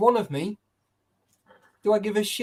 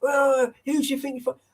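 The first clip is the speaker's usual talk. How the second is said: mock taxi driver; 10 semitones higher than usual. Quoting the taxi driver's outburst.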